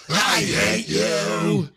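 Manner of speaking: very slow, unvoiced hateful rasp; imitating Anakin. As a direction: drag out a hoarse whispered hatred. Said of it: "Anakin's like".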